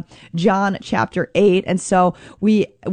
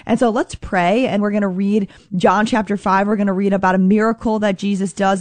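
ordinary delivery

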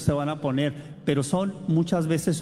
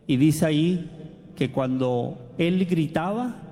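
ordinary talk

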